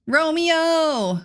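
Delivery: light up and sing out the name; excited greeting. Real, not acted. Streamer greeting a viewer whose build comes up, excited high call.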